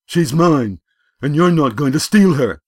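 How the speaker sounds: loud, high possessive shout; jealous anger. A narrator's reading. Bully claiming his girl from rival, angry shout. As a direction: stake your claim at full volume.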